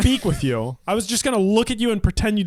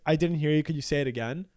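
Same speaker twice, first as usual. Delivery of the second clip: polite ask; 6 semitones lower, 5 dB quieter than usual. Shown as a demonstration. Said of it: "is it better to say".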